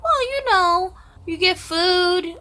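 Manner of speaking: high, slow, mock-sweet dodging voice; evasive foster mom. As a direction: play the foster mom, drawn out and high, brushing him off.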